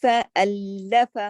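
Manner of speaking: فَأَلَّفَ with the doubled لّ held; shadda on lam. Shown as a demonstration. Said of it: "تشدید ہے نا". (فَأَلَّفَ میں لام پر تشدید)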